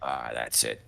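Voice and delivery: put-on low quiet old man voice, relieved; a soft pleased old mutter; old man mumbling. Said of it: "old man mumbling".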